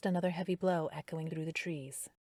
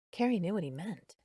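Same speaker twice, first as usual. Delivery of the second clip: ordinary delivery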